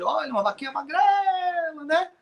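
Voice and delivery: a high put-on voice; high, surprised voice. Monk comments to the farmer on the skinny cow, in a high voice. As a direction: pitch it up high, eyeing the thin cow.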